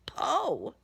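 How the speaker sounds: ordinary delivery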